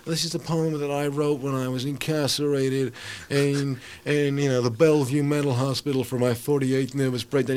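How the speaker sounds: slow, level mock poet recital; mocked confessional poet. Poet mocks open-mic confessional writers, droning imitation. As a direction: parody an earnest poet announcing their poem.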